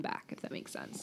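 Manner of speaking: whispered aside; whispering. Real, not acted. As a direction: drop to a whisper.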